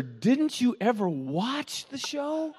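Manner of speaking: high, slow incredulous question; exasperated sidekick. Retold or imitated. Sidekick scolds her about their own show, slow and high. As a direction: drag it out in disbelief. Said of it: "Guy says to her".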